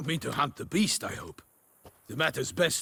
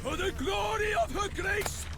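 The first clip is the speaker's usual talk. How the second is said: high ringing war cry, much higher; battle cry; 3 dB quieter than usual. Acted. Knight charging Geralt, a high ringing war cry. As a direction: call out to charge the foe.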